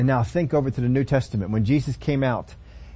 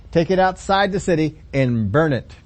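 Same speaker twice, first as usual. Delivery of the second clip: normal talking voice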